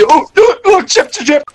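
loud, high excited yelling; excited shouting. Real, not acted. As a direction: burst out with excitement. Excited spotting in game.

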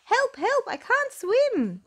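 very high, loud squeaky scream; drowning mouse squeaks in panic. Acted. Mouse in the water, very high loud squeaky panic. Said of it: "squeaked even louder".

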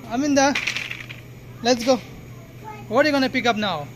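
ordinary talk

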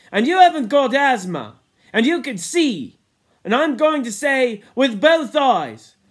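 high, loud, building tirade; impassioned Piggy. A narrator's reading. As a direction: plead your case at full voice, pushing to a forceful end. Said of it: "flushed and trembling".

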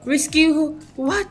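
high, loud burst of objection; alarmed protest. Real, not acted. Scolds flirting game characters.

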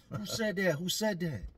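mock angry demand. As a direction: demand to know who spoke. D demanding again.

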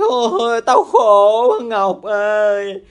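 loud, long drawn-out wail of misery; very slow, theatrical howling lament; mock bawling. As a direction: imitate a friend crying floods, hugely hammed-up. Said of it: "Nó khóc tràn gian đại hải".

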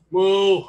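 loud, drawn-out moo; cow moo. Shown as a demonstration. Coach joking about what sound frogs make, loud cow moo. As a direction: let out a big sustained moo. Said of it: "I think it's moo".

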